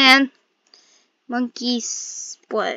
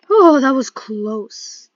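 relieved gasp; 2 semitones higher, 12 dB louder, slower than usual. Real, not acted. Relief after near catch.

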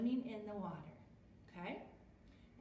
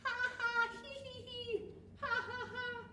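high story-book ha-ha and hee-hee; acted laughter; 13 semitones higher than usual. A narrator's reading. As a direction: laugh high for the tickled characters. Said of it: "they're all laughing".

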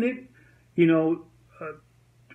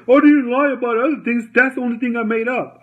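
higher, louder defensive protest; a lightly voiced, higher whiny excuse; defensive friend voice; 6 semitones higher, 4 dB louder, faster than usual. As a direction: play the caught liar pleading his case, pitched higher. Friend defends himself, retold.

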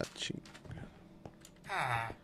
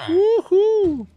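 excited whoop; 9 dB louder than usual. Real, not acted. Gamer cheers after a trade.

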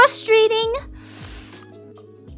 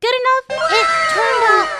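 excited; 7 semitones higher, 8 dB louder than usual. Real, not acted. Proud result.

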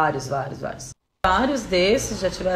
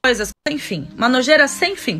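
loud, high, indignant outburst; real outrage, pitched high; outraged rant; 8 semitones higher, 9 dB louder than usual. Real, not acted. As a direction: hammer out the accusation at full voice. Outraged rant about a crime.

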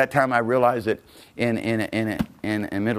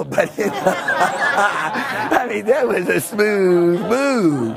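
slow, higher, laughing as you speak; laughing through words; 8 semitones higher, 4 dB louder, much slower than usual. Real, not acted. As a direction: chuckle out the words slowly. Laughing while telling.

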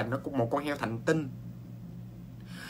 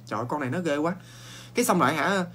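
normal talking voice

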